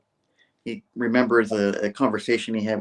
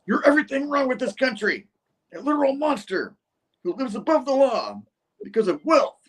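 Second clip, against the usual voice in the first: very high, impassioned accusation; cyborg guy; 14 semitones higher than usual. Retold or imitated. Cyborg denouncing Norman at a press conference, high ranting voice. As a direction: imitate a furious attacker, pitched way up. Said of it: "cyborg guy is like".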